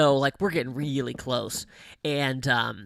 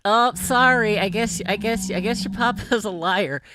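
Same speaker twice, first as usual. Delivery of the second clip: high, loud mocking sorry; teasing cast mate; 8 semitones higher, 7 dB louder than usual. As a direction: rib the dad with fake pity. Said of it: "and she's like".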